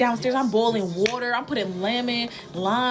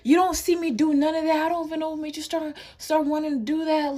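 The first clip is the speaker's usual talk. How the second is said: scolding mom; 7 semitones higher than usual. Retold or imitated.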